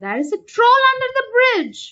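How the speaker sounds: high, loud, fast warning; frantic warning shout. A narrator's reading. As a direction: shout it before he steps on. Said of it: "they cried".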